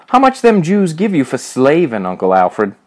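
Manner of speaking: high-pitched jeer, falling on the name; jeering question. A narrator's reading. Major mocks Alfred's work for the grocers, jeering. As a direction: jeer at him about his job. Bully jeers at Alfred's job.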